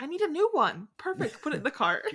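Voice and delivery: thrilled she found the perfect coffin; mock delight.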